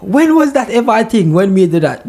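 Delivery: grandmother protesting her innocence, mock outrage; imitating grandmother. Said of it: "my grandmother say".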